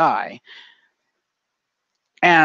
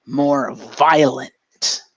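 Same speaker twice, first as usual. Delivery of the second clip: violent dragged out, very slow; a dark slow reveal of violent; slow heavy stress; much slower than usual. Retold or imitated. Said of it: "they're not gonna like me using this word".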